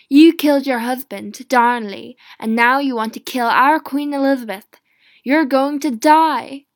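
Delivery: furious shout of an accusation; accusers shouting. Acted. Mary's accusers condemning her, in a furious shout. Lords accusing Mary of treason.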